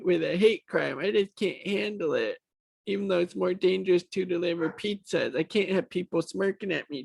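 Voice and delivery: high, petulant mock-victim voice; mocking whiny complainer. Commentator mimics deputy offended by smirking, mocking whine. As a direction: whine it high, making fun of the accuser.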